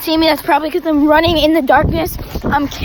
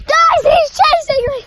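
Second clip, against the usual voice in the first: high, yelling in mock panic; playful scared shout while fleeing; being chased; 12 semitones higher, 6 dB louder, slower than usual. Kid chasing him at campfire.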